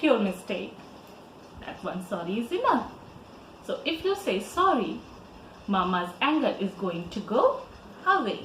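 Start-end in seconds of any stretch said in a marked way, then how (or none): none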